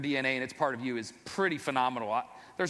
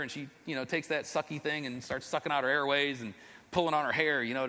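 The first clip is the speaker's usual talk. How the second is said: ordinary delivery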